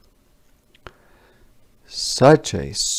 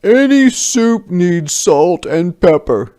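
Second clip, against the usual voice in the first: higher, louder hearty soldier; soldiers' voice; 8 semitones higher, 8 dB louder than usual. A narrator's reading. Soldier telling the villagers, in a higher, louder hearty voice. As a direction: boom it out like a sly soldier at the pot. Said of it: "said the soldiers".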